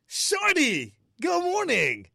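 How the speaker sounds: high, loud, delighted hollering; excited calling out. Real, not acted. Host hails caller Shorty on the line, excited calling out. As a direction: holler the nickname, then swoop down.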